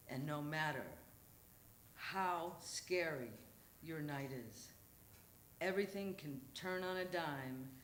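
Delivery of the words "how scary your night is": usual speaking voice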